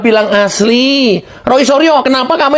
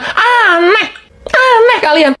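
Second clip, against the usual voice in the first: much higher, strained "aneh, aneh kalian"; outraged cry; 11 semitones higher, slower than usual. Real, not acted. Host condemning those blaming Jokowi, strained cry. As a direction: cry out in indignation.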